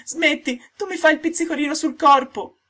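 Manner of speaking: the tiny voice begging to stop the tickling; tiny giggly voice. Acted. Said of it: "la solita vocina che gli disse ridendo". (vocina acutissima e divertita per il solletico)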